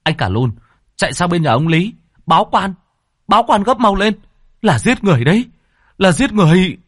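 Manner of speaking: high, loud, urgent commands; urgent orders. A narrator's reading. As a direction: send him running, no time to lose. Ordering a run to fetch help. (ra lệnh gấp gáp, to và cao giọng)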